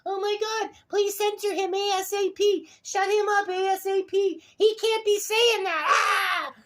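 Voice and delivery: very high squealing falsetto; mock panicked complainer. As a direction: mimic them in a hammed-up panic, ending in a squeal. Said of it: "So what do you do instead?".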